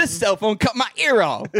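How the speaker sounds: Tracy Morgan impression.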